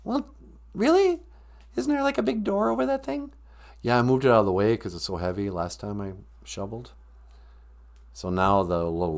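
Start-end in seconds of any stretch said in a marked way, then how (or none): 0.0-3.3 high, small questioning voice; high-voiced sidekick, doubtful. Sidekick questioning partner about the cellar door, high voice. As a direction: ask it high, in disbelief.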